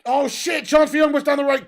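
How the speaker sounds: high, loud alarmed play-by-play; sudden burst of worried excitement; excited commentary. Real, not acted. Live commentary, sudden chance.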